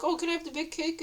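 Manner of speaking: high, nice little request; happy, unthreatening. Retold or imitated. Showing the man she is harmless, happy and nice. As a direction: ask sweetly, nothing threatening in it. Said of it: "And I'm, like, happy".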